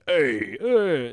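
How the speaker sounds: high, loud goofy dog voice; pet's put-on voice, rising high; dog voice for Finn. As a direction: voice the family dog. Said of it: "I have my voice for Finn".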